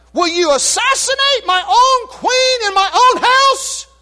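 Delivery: enraged, slow, loud command; furious king. Retold or imitated. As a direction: seize him in a royal rage. King orders Haman seized.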